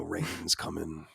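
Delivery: low, slow, soft mock Southern cowboy; ominous cowboy warning, dragged out low; Red Dead cowboy voice. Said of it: "This is Red Dead voices".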